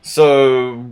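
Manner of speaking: ordinary delivery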